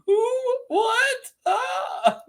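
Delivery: very high mock-startled squeal; startled reply imitation. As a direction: squeak it out in comic alarm. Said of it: "He's like".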